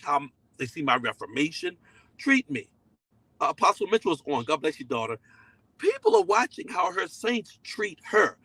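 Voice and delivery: ordinary delivery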